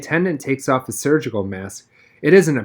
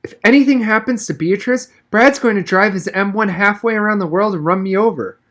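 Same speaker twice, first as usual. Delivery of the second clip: freaking out; 8 semitones higher than usual. A narrator's reading. Pregnant Katie fears her husband's anger, high panic. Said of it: "Katie was freaking out".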